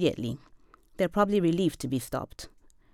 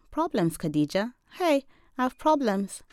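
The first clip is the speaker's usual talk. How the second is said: sad; 7 semitones higher than usual. Retold or imitated. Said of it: "I shake my head sadly".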